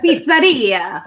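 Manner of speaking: slow, hammed-up Italian pizzeria; Italian accent. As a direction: stretch it out like a cartoon Italian waiter. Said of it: "It's like".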